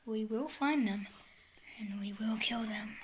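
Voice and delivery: menacing whisper, low; villain whisper. Pretend. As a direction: whisper the threat like a madman. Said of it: "Is this guy insane?".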